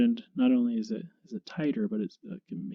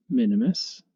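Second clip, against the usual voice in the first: usual speaking voice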